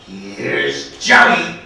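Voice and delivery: drawn-out, exploding movie-maniac greeting; Jack Nicholson catchphrase. Acted. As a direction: stretch the first word, then yell the name. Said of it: "greeting with this line".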